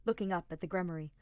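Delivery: ordinary delivery, nothing marked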